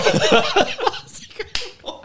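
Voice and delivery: long high burst of laughter; high helpless laugh. Real, not acted. Host laughing at his own croc story, high wheezy laugh. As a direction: laugh till you can't breathe.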